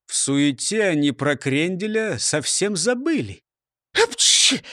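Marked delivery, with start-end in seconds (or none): 3.9-4.6 high voiced sneeze; a loud sudden sneeze. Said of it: "чихнув". (чих)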